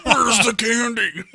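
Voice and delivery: loud, high, drawn-out holler; hollered catchphrase impression. Joking about what the story reminds him of, hollered impression. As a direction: stretch it out in a slow, high mock yell.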